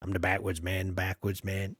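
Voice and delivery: a low, murmured movie quote; quoting the backwards man. Retold or imitated. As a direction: imitate the film line low and soft. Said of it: "I know what you mean".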